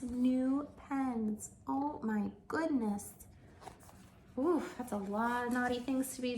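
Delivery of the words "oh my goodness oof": ordinary delivery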